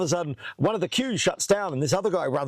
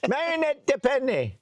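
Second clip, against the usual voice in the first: French official; 12 semitones higher than usual. Retold or imitated. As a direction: call the name once more like the French officer. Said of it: "And he's like".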